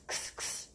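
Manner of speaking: whispered ks, ks isolated; voiceless ks sound demo. Shown as a demonstration. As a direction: breathe out a crisp ks, ks. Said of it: "pra ter esse som de ks".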